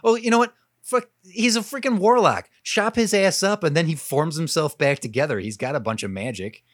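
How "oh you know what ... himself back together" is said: high, loud burst of excitement; suddenly fired up by the idea; excited. Real, not acted. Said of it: "sudden idea".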